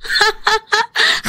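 high mocking villainess laugh; high scornful cackle. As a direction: laugh off her threat. Mother-in-law mocks threat.

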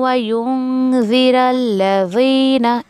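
slow careful recitation of व युनज़िरल्लज़ीना; tajweed recitation. Shown as a demonstration. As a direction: recite व युनज़िरल्लज़ीना slowly with nasal युं. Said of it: "इस तरीके से आपको करना है".

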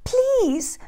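high pleading please; begging. A narrator's reading. Jack begs the old woman to let him in, pleading. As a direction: plead like a hungry boy. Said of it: "Jack begged".

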